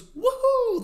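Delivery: gleeful roller-coaster whoop, imitated; excited mock scream. YouTuber imitates the oblivious cyclist, excited mock scream. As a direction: whoop it high and loud. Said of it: "so excited that he screams".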